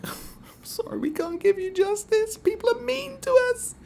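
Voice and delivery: whispered, then a high whimpering whine; mock whiny police. Mocking police who whine about justice, whispered high whimper. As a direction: play the police as pitiful victims in a tiny whisper. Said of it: "the police are like".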